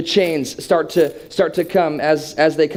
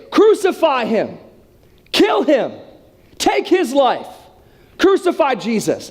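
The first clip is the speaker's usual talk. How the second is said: higher, drawn-out mock mob yell; crowd yelling; 5 semitones higher than usual. Crowd demands Jesus be crucified, acted high yelling. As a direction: cry out as a hostile crowd. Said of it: "And they yell it and yell it".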